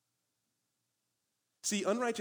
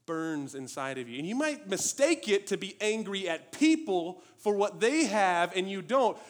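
usual speaking voice

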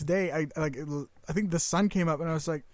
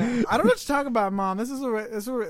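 put-on fast, louder, higher defensive kid; defensive teen; 5 semitones higher, 4 dB louder than usual. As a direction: brush off mom quickly. Retold defensive reply to mom.

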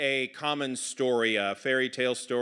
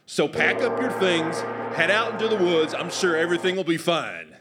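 higher, declaring Southern dad voice; stern Southern father; 4 semitones higher, 5 dB louder than usual. Acted. Father sends children away from home, stern Southern pronouncement. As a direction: lay down the law in a Southern accent.

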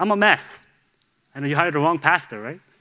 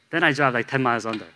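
ordinary talk, nothing marked

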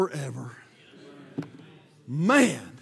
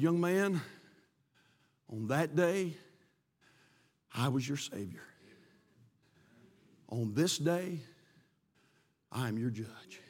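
much lower, much softer, slow; solemn judge; 8 semitones lower, 11 dB quieter, slower than usual. Retold or imitated. Judge sentencing the man he once saved, low and hushed. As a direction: deliver the verdict heavy and hushed.

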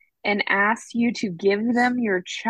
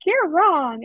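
high, punched contradiction; mock arguing; 8 semitones higher, 3 dB louder than usual. High school student contradicting teachers, mock argumentative. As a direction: imitate a cocky student, pitch jumping up. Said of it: "used to argue with our teachers".

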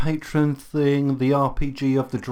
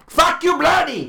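mock angry; slower than usual.

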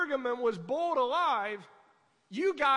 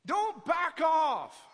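loud, drawn-out command, word by word; slow shouted command; 3 semitones higher, 5 dB louder, much slower than usual. Real, not acted. Pastor commands his flock never to retreat, slow and loud. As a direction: hammer each word slowly and loud.

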